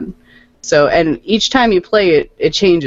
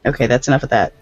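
normal talking voice

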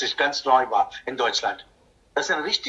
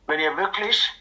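ordinary delivery

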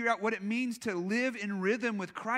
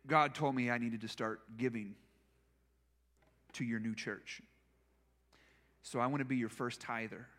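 soft, much quieter, fighting tears; telling it through real emotion; emotional, near tears; 9 semitones lower, 5 dB quieter than usual. Real, not acted. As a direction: softly, close to crying. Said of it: "if I start crying, just leave me alone".

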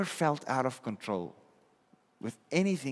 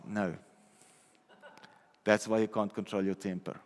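normal talking voice

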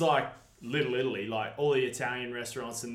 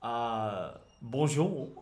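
put-on French accent; much slower than usual. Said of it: "which is French".

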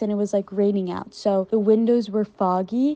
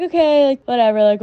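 mock-casual shrug; 5 semitones higher, 5 dB louder than usual. Girls shrugging off going along.

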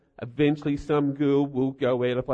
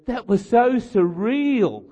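mock awe; 7 semitones higher, 5 dB louder, slower than usual. Quoting fan's breathless awe.

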